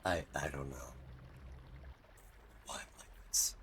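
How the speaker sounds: hushed, embarrassed mumble; whisper. Real, not acted. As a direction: mutter it to yourself, whispering. Embarrassed aside after impression.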